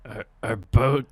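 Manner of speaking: bust out a booming action-hero voice; booming gruff voice. Acted. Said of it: "really busts out the voice".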